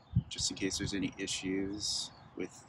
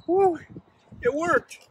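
excited cheer; 5 dB louder than usual. Real, not acted. Valve test succeeds, cheering.

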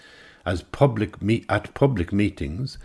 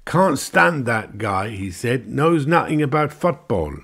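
higher, louder scorn; scornful grumble; 7 semitones higher, 4 dB louder than usual. Acted. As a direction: dismiss the man with contempt. Englishman grumbles.

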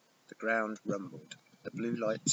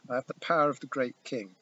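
defiant loud warning; 3 semitones higher, 4 dB louder than usual. Acted. My father roared.

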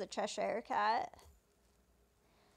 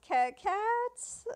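imitated stutter on "cats", rising high into a question; higher-pitched, stammered "Ca-, cats?"; 9 semitones higher, 3 dB louder than usual. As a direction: trip over the first syllable of "cats", then pitch it up. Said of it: "he, like, stutters the word cats".

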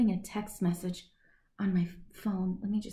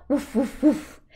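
dog barking; 4 semitones higher than usual. Acted. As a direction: bark like a friendly dog. Dog barks in the story.

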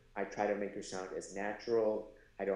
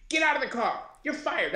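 mock scolding; 6 dB louder than usual. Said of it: "scold him".

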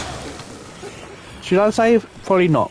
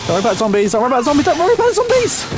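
building urgency; 10 semitones higher, 3 dB louder, faster than usual. Real, not acted. Player warning teammates off zombies, urgency climbing to frantic. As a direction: start raised, climb to a very high, frantic pitch.

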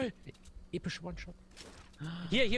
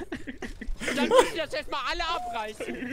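laughing; 5 semitones higher, 4 dB louder, slower than usual. Real, not acted. Laughing recap of teammate's blunders.

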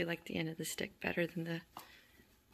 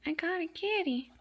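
high, gleeful cooing; pet talk; 9 semitones higher, 4 dB louder than usual. Real, not acted. Kitten caught on the toy, high pet talk.

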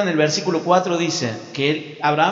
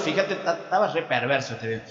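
ordinary delivery

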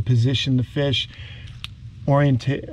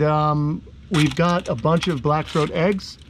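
usual speaking voice

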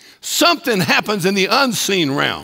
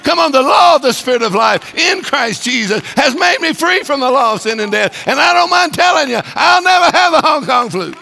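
louder, higher, building to a shouted declaration; full-force preaching; 5 semitones higher, 4 dB louder than usual. Acted. Hagin answering the fearful preacher, building full-force preaching. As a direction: defy the devil out loud.

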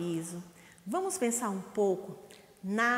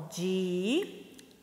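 Di-a-man-te split into four slow claps; syllable by syllable; 4 semitones lower than usual. Shown as a demonstration. Teacher clapping out syllables for the children. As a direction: clap out each syllable of Di-a-man-te. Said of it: "Uma, ó".

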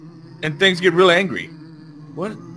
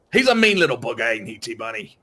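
lightly voiced Aussie bloke; mock Australian accent; 6 semitones lower than usual. Host's light Australian accent asking the co-host about the dino. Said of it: "I can't do this accent anymore".

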